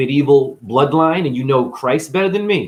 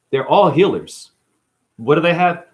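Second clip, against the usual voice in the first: ordinary talk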